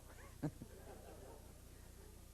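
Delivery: quiet airy chuckle; little breathy laugh under the breath; soft breathy laugh. Real, not acted. Laughs after odd story.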